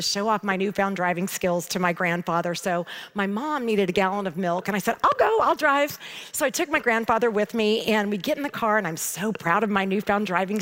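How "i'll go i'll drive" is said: eager offer. Retold or imitated. Eager teen volunteering to drive.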